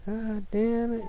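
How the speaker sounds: high, slow mock yell at the barking dogs; imitating roommate yelling. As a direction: screech it out drawn-out like the fed-up roommate. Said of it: "He be screaming and yelling".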